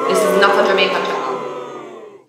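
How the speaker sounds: same line again with a Jamaican accent; Jamaican accent repeat. Shown as a demonstration. Insisting to viewers about the channel, Jamaican accent.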